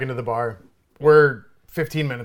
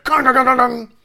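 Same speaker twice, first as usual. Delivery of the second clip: loud high imitated clanging; chair clang; 11 semitones higher, 6 dB louder than usual. Imitating the metal bar stool hitting the floor, loud clanging. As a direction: mimic a metal chair bouncing. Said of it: "So it's like".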